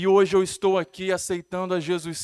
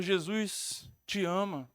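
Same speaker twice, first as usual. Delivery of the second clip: soft, low, drawn-out retold voice; slow, gentle-volume quote of a voice; distant first call; 3 semitones lower, 6 dB quieter, much slower than usual. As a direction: recount a faint voice, slowly and low. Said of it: "aquela voz fala mais forte".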